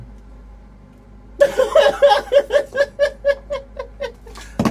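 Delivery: shrieking giggle fit; cracking up at herself; high laughing fit. Real, not acted.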